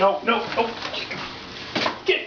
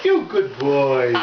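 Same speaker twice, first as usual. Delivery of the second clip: slow low praise; 8 semitones lower, slower than usual. Real, not acted.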